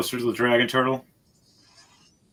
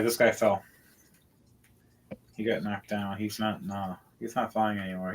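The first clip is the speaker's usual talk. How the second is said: usual speaking voice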